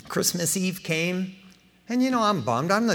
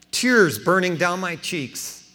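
usual speaking voice

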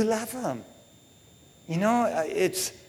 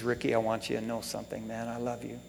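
low, soft and quick; dying father's confession; 6 semitones lower, 12 dB quieter, faster than usual. Retold or imitated. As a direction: quietly retell a father's tender confession. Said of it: "he says".

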